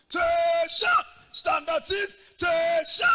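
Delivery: loud, high barked orders; shouted drill command. Retold or imitated. Gideon drilling his troops, shouting commands. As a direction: bark it like a drill sergeant.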